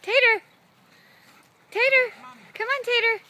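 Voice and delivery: high, loud calling; calling the dog. Real, not acted.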